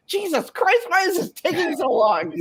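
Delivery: high-pitched, hammed-up impatient whine; mock exasperation. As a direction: act fed up with waiting, pitch way up. Acting impatience at a microwave.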